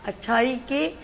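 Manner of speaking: slow, heartfelt verse recital; poetry recitation. Acted. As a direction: recite a farewell couplet with feeling. Anchor's farewell couplet. (جذباتی انداز میں شعر پڑھنا)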